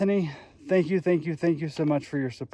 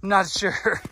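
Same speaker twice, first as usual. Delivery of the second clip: ordinary talk, nothing marked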